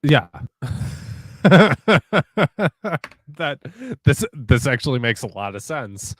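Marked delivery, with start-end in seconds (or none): 0.3-3.1 long, high run of chuckles; real laughter at what was just played; delighted laugh. Real, not acted. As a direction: laugh in a high, repeated staccato. Reacts to the generated music.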